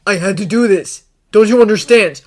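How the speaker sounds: loud, urgent, rising insistence; desperate plea. A narrator's reading. Harry begging Draco to understand, desperate loud plea. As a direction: plead loudly to be understood.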